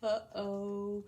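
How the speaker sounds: ordinary talk, nothing marked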